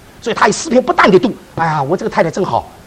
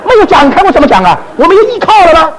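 higher and louder, panicking with a laugh; half-laughing mock panic, pitched up and loud; recalled panic; 8 semitones higher, 4 dB louder than usual. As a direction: relive the terror of no podium to lean on.

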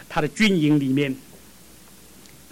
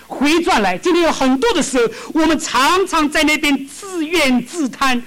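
high, loud, slow pulpit cry; fervent preaching; 9 semitones higher, 5 dB louder than usual. Acted. Preacher urging hearers to turn back, fervent cry. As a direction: press the point on the congregation.

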